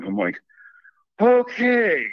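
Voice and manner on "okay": high, drawn-out mock reaction with a laugh; hammed-up, laughing acknowledgment; exaggerated okay. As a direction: pitch it way up, playfully taken aback. Mock reaction to a cagey answer.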